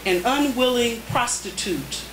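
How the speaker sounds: spare, paused haiku reading; deliberate poetry reading; haiku recitation. Acted. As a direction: let each haiku land.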